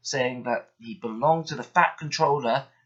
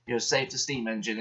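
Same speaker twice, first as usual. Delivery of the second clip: ordinary delivery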